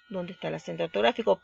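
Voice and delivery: normal talking voice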